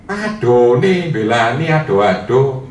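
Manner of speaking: thick Javanese medok, high and drawn out; medok Javanese accent. Retold or imitated. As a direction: play the woman from Surabaya in heavy medok. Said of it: "Dalam bahasa yang medok seperti itu". (logat medok Jawa yang kental)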